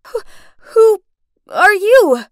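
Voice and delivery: high, loud, halting stammer; frightened stutter. Acted. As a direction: stammer it out in alarm, breaking after the first word. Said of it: "I stuttered".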